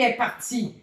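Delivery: ordinary talk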